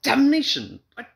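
high, loud exasperated cry; exasperated outburst. A narrator's reading. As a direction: burst out in disbelief. Husband outburst.

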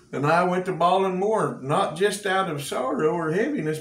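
ordinary talk

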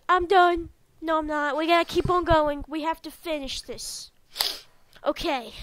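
usual speaking voice

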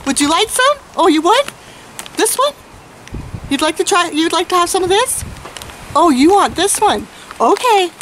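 high, bright carnival barker call; chirpy trade show pitch. Acted. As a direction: sell it like a fairground barker, all smiles. Fair-style sales pitch to customers.

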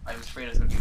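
usual speaking voice

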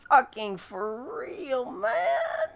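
exasperated. Real, not acted. Exasperated whine after a fall.

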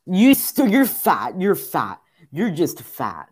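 high, loud insults, easing down step by step; rage outburst. Real, not acted. Player about to rage quit insults game character, angry yelling. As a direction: burst out yelling, then slowly run out of steam. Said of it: "I'm gonna rage quit".

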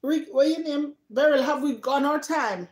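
ordinary delivery

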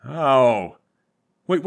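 ordinary talk, nothing marked

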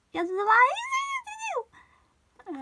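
very high, drawn-out cartoon squeal; squeaky-high cartoony singsong, held long. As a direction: stretch it out in a silly, soaring cartoon voice. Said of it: "like, a cartoony voice".

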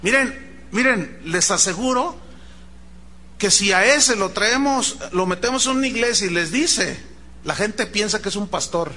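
normal talking voice

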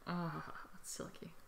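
hushed, breathy whisper. Real, not acted. Touching her friend's fresh haircut, whispered. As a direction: whisper it softly, almost unvoiced.

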